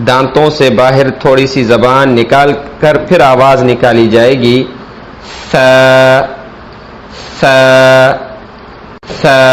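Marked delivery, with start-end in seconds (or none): 5.5-9.5 سا with the tongue tip between the teeth, a lisped th. Shown as a demonstration. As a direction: push the tongue past the teeth for سا. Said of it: "تھوڑی سی زبان باہر نکالیں".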